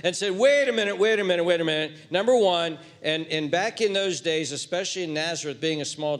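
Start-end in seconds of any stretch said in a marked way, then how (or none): 0.3-2.8 loud, urgent objection; indignant self-concerned protest. Acted. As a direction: break in loudly to object, acting it out. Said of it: "filled right now with self-concern and said".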